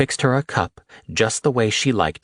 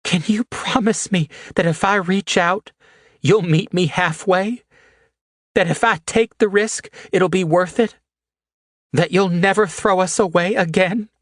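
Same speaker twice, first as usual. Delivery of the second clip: much higher, aching plea; Haley, pleading; 11 semitones higher than usual. A narrator's reading. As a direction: beg him to meet her halfway.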